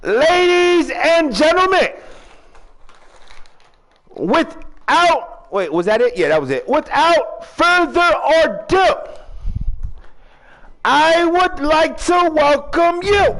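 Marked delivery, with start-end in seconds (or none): 0.0-5.5 high, slow, drawn-out announcer call; ring announcer. Pretend. Hosts opening his wrestling show. 6.7-13.4 high, slow, drawn-out announcer call; ring announcer. Pretend. Hosts opening his wrestling show.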